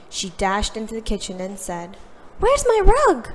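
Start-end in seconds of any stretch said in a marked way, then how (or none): 2.4-3.3 much higher, louder panicked question; alarmed cry. Acted. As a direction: burst in, frantic for the rug. Emma discovers her rug missing.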